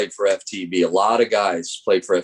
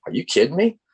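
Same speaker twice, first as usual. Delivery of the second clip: high-pitched incredulous question; can't-believe-it rising question; retold disbelief; 8 semitones higher, faster than usual. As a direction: replay your astonishment at an offer, pitch climbing. Retold reaction to job offer.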